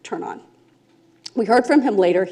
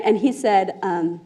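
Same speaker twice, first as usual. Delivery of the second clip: ordinary talk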